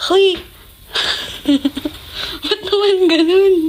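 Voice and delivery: high, loud laughing disbelief; shocked laughing protest. Real, not acted. Daughter reacting loudly to dad's bad advice, shocked laugh. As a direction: yelp in shock, then laugh it off.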